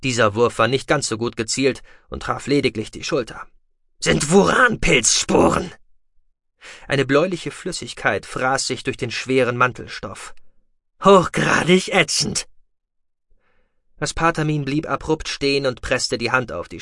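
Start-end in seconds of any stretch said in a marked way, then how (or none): 4.0-5.7 loud, slow and raised in pitch; fierce taunt. A narrator's reading. Taunting enemy with thrown spores. 11.0-12.5 loud, slow, high-pitched taunt; fierce gloating. A narrator's reading. Gloating over corrosive spores in combat.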